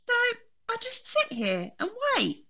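high keen cub asking Mummy; eager little bear. Acted. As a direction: ask Mummy hopefully. Fred questions Mummy.

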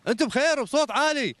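a high, full shout out to the hall; shouting to the crowd. Real, not acted. Host shouting to wake the sleepy audience, high festive shout. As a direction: rouse a sleepy crowd with a big call. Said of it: "بعدهم نايمين الجماعة".

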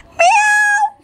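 very high, drawn-out meow; high-pitched cat imitation for children. Shown as a demonstration. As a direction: squeal a long meow like a cat. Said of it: "here we have the sound of cat".

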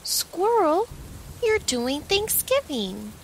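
delighted. Acted. Joyful realization.

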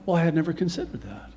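normal talking voice

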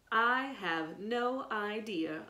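slow, drawn-out mocking echo; slow sneering mimicry of Evan. A narrator's reading. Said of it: "a voice said mockingly".

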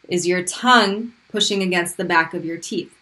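normal talking voice

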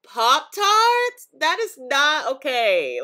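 loud, high disbelief; outraged. Real, not acted. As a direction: protest the idea loudly. Outraged at Popeyes Pop-Tarts.

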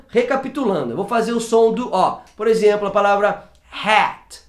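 ordinary talk

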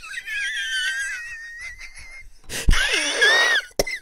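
long, high squealing laugh; laughing fit. Real, not acted. Streamer cracks up over his friend, high laugh. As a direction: crack up in a high-pitched wheeze.

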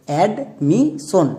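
Admission very slowly in parts, ad... mi... shon; syllable by syllable. Shown as a demonstration. Teacher has pupils sound out the word, admission slowly syllable by syllable. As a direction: break admission into separate, drawn-out syllables. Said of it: "একসঙ্গে উচ্চারণ করো".

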